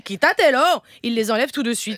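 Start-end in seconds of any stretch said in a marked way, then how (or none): 0.0-0.6 sharp Spanish order; Spanish command. Retold or imitated. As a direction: snap it at the kids in Spanish. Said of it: "Mais quand je dis". (ordre sec en espagnol aux enfants)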